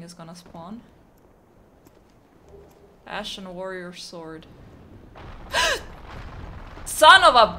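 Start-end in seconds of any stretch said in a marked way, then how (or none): 4.5-6.8 gasp. Real, not acted. Sudden drop in game. 6.8-7.6 sudden loud outburst; shout. Real, not acted. Falls into trap, shouts.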